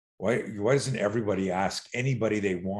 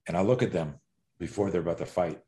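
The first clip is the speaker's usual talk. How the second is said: ordinary talk, nothing marked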